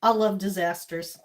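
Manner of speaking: ordinary talk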